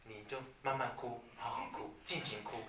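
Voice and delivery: soft, hushed and unhurried, almost whispered; gentle calm comfort. Shown as a demonstration. Parent softly letting a crying child cry it out. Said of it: "你只要跟他讲".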